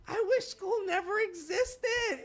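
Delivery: put-on high, slow, tearful kid's wail; crying child. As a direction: play the heartbroken little boy at the door. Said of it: "he goes".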